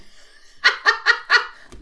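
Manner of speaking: short high, loud laugh; real laugh. Real, not acted. Reader amused at her own comparison, laughing.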